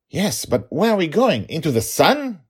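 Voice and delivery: high, loud, eager questioning; clueless playful dad. Acted. Dragon father suggesting wrong places to daughter, cluelessly eager. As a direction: toss out a silly guess with lifted pitch.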